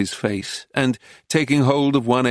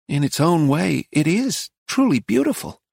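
higher, dreamy admiration; high admiring woman; 5 semitones higher than usual. A narrator's reading. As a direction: gaze and admire.

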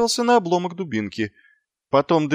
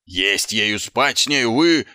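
yelling sergeant; 6 semitones lower than usual. A narrator's reading. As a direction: bellow it at the recruits, building. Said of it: "заорал он". (крик сержанта)

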